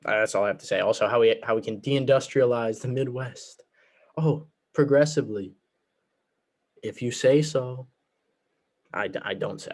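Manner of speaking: ordinary talk, nothing marked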